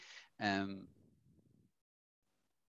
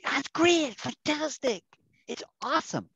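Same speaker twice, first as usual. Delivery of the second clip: much higher, louder over-the-top gushing; gushing American; 8 dB louder than usual. Retold or imitated. Teacher mocks how Americans overstate, gushing. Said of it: "they'll say".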